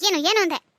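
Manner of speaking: angry retort. Acted. Wife angrily demands what her husband said, loud. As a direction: fire the question back at him.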